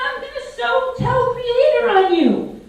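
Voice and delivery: high, sing-song insect voice; tiny mosquito threatening to tell on the snake; tattling mosquito. A narrator's reading. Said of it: "Mosquito was all in a tizzy".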